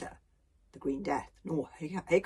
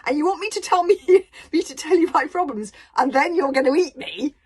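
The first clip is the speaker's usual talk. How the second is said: put-on incredulous boy; 10 semitones higher, 14 dB louder than usual. Hiccup incredulous.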